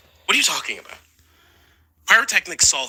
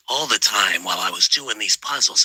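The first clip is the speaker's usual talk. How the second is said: ordinary talk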